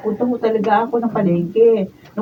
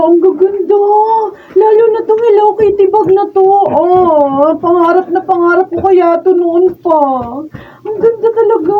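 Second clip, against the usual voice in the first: gushing excitement; 7 semitones higher, 5 dB louder than usual. Acted. Girl gushing over bag.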